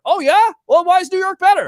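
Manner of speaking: high, fast, loud challenge; indignant comeback in a pizza argument; heated challenge. Real, not acted.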